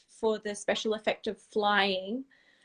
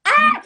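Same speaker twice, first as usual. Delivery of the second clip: sudden loud, high-pitched "Ah!"; panicked yelp; 14 dB louder than usual. Retold or imitated. Singer reliving a stuck zip backstage, panicked yelp. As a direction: cry out as if the zip has jammed.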